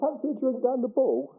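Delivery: playful put-on Suffolk accent; Suffolk local accent. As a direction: sound like a friendly Suffolk local. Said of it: "imitate my terrible accent".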